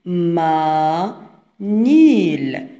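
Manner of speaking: "ما، نيل" slowly drawn out, a break after ما; split into syllables. Shown as a demonstration. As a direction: stretch "ما" and "نيل" as two separate syllables. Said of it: "manil فيها deux syllabes". ("ما، نيل" مقطع بمقطع، ببطء وبمد)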